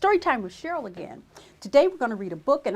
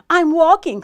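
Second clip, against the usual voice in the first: loud, higher irritated retort; snappish; 6 semitones higher, 11 dB louder than usual. A narrator's reading. Grumpy Bird answers Raccoon's question, loud snappish retort. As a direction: snap it back as a grumpy bird. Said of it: "snapped Bird".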